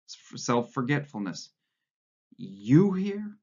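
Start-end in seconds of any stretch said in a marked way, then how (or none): 2.4-3.4 sudden high startled question; a jolt of surprise, voice jumping up. A narrator's reading. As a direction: blurt it out in shock. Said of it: "Winston was startled".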